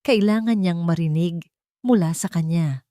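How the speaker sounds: ordinary delivery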